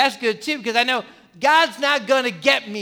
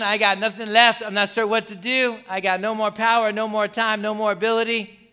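ordinary talk

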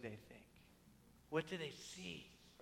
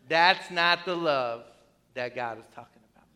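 ordinary talk, nothing marked

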